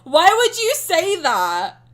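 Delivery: loud, slow, drawn-out mock protest; mock outrage. Streamer protesting a chat joke, mock loud outrage. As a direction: wail it out as if wounded by the comment.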